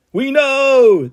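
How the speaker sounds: high, drawn-out yell. A narrator's reading. Crowd of Andys answering in unison, yelling. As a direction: holler it like a whole crowd. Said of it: "they yell again".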